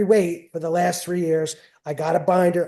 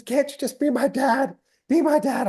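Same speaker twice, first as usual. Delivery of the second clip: higher, pleading daughter voice; imitating crying daughter; 9 semitones higher than usual. Daughter after a lost match pleads with her father, higher and pleading. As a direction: beg to just be her dad. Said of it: "She says".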